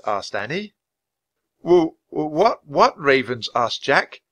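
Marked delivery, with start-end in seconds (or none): 1.6-3.5 high, stammering fright; nervous boy with a trembling voice. A narrator's reading. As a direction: stumble over the first word in fear. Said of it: "his voice trembling".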